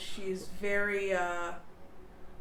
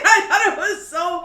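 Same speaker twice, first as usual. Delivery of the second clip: normal talking voice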